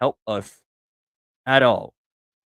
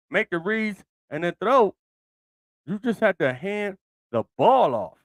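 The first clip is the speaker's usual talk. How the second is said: higher and louder, worked-up disbelief; exasperated rant; 9 semitones higher, 5 dB louder than usual. Real, not acted. Fan venting to viewers about the quarterback, higher and heated. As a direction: build the outrage over an easy handoff. Ranting about the easy handoff play.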